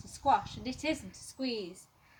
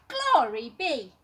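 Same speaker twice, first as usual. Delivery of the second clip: loud, higher, drawn-out exclamation; dismayed cry; 4 semitones higher, 9 dB louder than usual. A narrator's reading. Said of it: "The little old lady cried".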